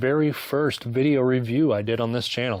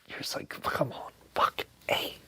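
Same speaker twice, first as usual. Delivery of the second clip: whisper; 8 dB quieter than usual. Retold or imitated. Reacting to creepy orgy scene.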